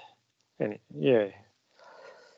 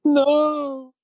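high squeaky no; 7 dB louder than usual. Pretend. Playful refusal.

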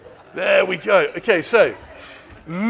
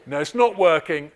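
ordinary talk, nothing marked

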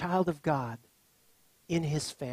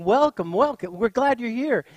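loud, raised-pitch family greeting; acting out an exuberant welcome; excited welcome; 6 semitones higher, 8 dB louder than usual. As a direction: greet a long-lost relative eagerly. Relatives greeting a long-lost cousin.

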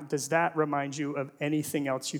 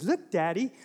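put-on higher, slower, delighted child's voice; little daughter; 7 semitones higher than usual. Dad imitates his daughter pointing at the fish, high and delighted. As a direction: play the small girl showing off her goldfish. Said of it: "And she goes".

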